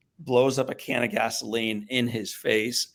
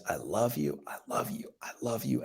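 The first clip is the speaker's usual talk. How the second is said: tender repeated love; 8 dB quieter than usual. Retold or imitated. Dad telling his burned son he loves him, hushed. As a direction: breathe it out softly to the boy. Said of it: "And then he says".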